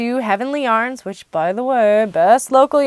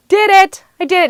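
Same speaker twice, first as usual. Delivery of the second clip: triumphant cheer; 9 semitones higher, 5 dB louder than usual. Real, not acted. Celebrating sock count.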